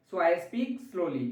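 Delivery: very slow, each word stretched; slowly. Shown as a demonstration. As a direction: demonstrate speaking slowly. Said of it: "मैं धीरे धीरे बोलता हूँ". (बहुत धीरे धीरे बोलना)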